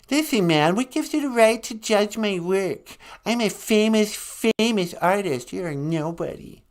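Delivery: hammed-up grumpy artist; mock angry Van Gogh. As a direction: fume at the host as a peeved painter. Van Gogh scolds host.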